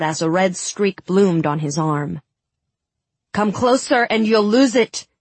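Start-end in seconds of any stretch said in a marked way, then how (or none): 3.3-5.1 higher-pitched angry snap; snapped threat. A narrator's reading. Tamar threatens the pressing crowd with axes drawn, snapping. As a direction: snap it as a warning. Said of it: "she snapped".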